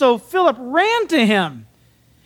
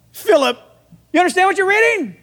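Philip asking; 7 semitones higher than usual. Acted. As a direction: fire the question up high and fast. Philip questions the Ethiopian again.

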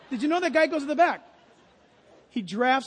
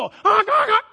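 much higher, louder honks; loud goose honks; 10 semitones higher, 12 dB louder, slower than usual. Retold or imitated. Pastor imitating the flock at the turn, loud honks. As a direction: let the whole flock honk.

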